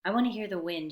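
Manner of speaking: usual speaking voice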